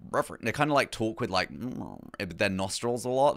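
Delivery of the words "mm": nasal posh hum. Shown as a demonstration. Streamer showing chat how posh people talk, very nasal. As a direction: hum through the nostrils. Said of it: "They, like, very nasally".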